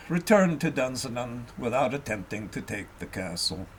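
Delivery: usual speaking voice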